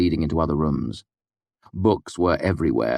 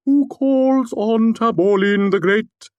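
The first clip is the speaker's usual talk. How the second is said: slow, much higher and louder, a grand wizard proclaiming; resonant intoning; 6 dB louder, slower than usual. Acted. Puppet greets visitors as Taborlin, grandly intoning. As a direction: intone it like a hammed-up legendary wizard. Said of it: "Puppet intoned, his voice resonant".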